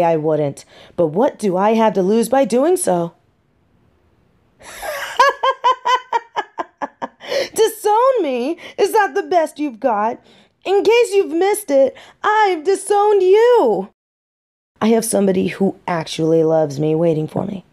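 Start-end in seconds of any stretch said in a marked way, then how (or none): 4.8-7.5 long, high-pitched mocking laugh; scornful laugh. Daughter scoffing at being disowned, derisive laugh. 7.6-13.9 high-pitched, incredulous scorn; scornful defiance. Acted. Daughter disowning her parents right back, scornful.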